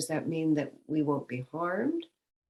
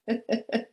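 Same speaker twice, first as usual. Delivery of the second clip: short laugh; 7 semitones higher than usual. Real, not acted.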